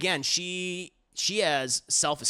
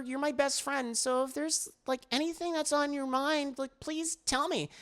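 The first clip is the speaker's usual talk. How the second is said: gentle concern; 9 semitones higher than usual. Retold or imitated. Madoka gently asking.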